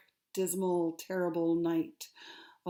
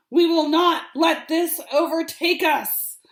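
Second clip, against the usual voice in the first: much higher, much louder rallying cry; commanding shout over the storm; 8 semitones higher, 13 dB louder than usual. Acted. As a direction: bellow it over howling wind to rally the crew. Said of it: "over the wind, a voice was heard".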